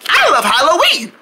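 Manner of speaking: high, gushing mimicry; friend impression. [name] imitates a friend on request, high eager voice. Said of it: "Can you do me too?".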